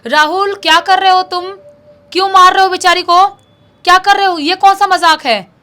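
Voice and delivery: acted indignant yelling, high and forceful; loud scolding shout. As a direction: shout up at him, demanding answers. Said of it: "ज़ोर-ज़ोर से आवाज़ लगाने लगती है".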